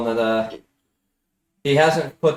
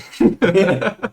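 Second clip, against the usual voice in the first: laughing; 9 semitones higher, 6 dB louder than usual. Real, not acted. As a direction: burst of loud high laughter. Laughing mid death scene.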